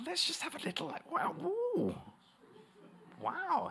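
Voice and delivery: high, soft mock-scandalised cooing; gossipy. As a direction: put on an eager gossip's voice. Mimics people enjoying tittle tattle.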